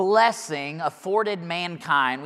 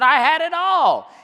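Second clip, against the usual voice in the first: emphatic boast; 9 semitones higher, 6 dB louder than usual. Acted. Preacher voicing Solomon's boasting.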